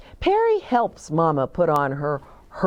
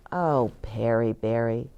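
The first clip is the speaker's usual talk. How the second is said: plain reading voice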